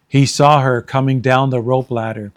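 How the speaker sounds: ordinary delivery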